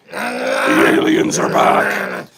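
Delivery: very low, slow, eerie drone; spooky alien voice. Pretend. Host warning viewers the aliens are back, deep eerie drone. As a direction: intone it like a monster from the deep.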